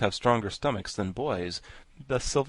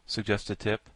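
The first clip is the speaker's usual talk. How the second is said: ordinary delivery, nothing marked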